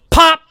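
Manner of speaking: sharp high loud pop sound; imitated knee pop. Mimicking the sound his knee made in down dog. Knee suddenly pops during stretch.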